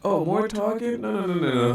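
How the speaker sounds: high-pitched dismissive protest; exaggerated whiny rejection; mock complaint. Acting out skipping the boring talk.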